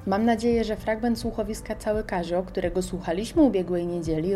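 usual speaking voice

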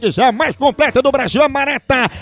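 upbeat radio ad pitch for the coffee; coffee ad read. Acted. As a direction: sell the coffee like a live radio spot. Host reads coffee ad. (leitura de comercial animada)

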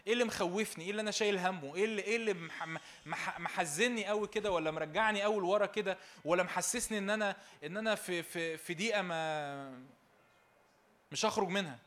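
loud, fast, rising sermon delivery; full-force preaching. Acted. Preacher rousing a joyless congregation, loud fervent preaching. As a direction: build to a fervent preacher's cry.

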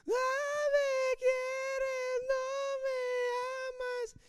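very high, drawn-out wail; exaggerated whiny sobbing, each word stretched; mock crying. Wife still pleading with an unmoved Samson, mock crying. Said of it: "sigues llorando".

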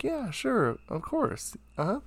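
high, soft mock agreement; everyone agreeing. The crowd going along with the band's manager, in a high soft voice. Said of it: "So everyone's like".

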